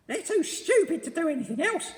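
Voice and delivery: put-on very high, loud, sneering old woman; cackling nasty wife; Missus Twit voice. A narrator's reading. Missus Twit sneering at the monkeys, shrill and loud. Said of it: "said Missus Twit".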